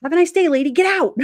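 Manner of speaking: playfully imitating a blunt goodbye; mock dismissive send-off. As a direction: shoo her out with a cheeky retold line.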